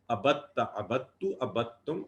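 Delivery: normal talking voice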